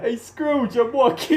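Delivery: high puppet frog protest; Kermit. Shown as a demonstration. Frog calling out to Scrooge, high protest. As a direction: hail the miser as a frog puppet. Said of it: "How does Kermit sound?".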